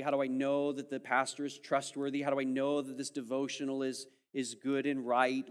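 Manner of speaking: ordinary talk, nothing marked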